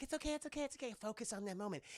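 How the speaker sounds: calm her down gently; mock soothing. Soothing quote of Lorraine.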